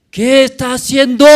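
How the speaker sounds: high, full-force yell; angry shout. Acted. Preacher voicing Christ causing a scandal in the temple, angry shout. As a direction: shout it like an outraged troublemaker.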